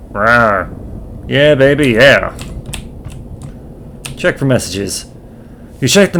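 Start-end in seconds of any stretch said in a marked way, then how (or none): 0.1-0.7 a playful mock dinosaur rawr; dinosaur roar. Player growls as the dinosaur, mock roar. 1.3-2.4 a put-on lounge-lizard yeah baby; groovy swinger voice. Dinosaur player hams up a swinger voice.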